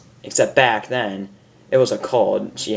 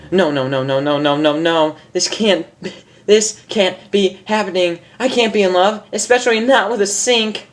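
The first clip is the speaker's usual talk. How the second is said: high, rising frantic denial; panicked denial; 7 semitones higher than usual. Acted. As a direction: spiral into panic over each no. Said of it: "suppressing the urge to break down".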